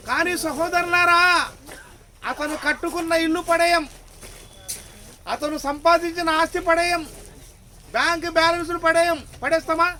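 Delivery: loud rhetorical sermon questions; preaching at full force. Acted. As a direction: drive each question home to the crowd. Preacher rouses the congregation.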